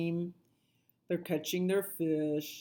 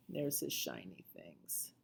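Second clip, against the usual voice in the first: ordinary delivery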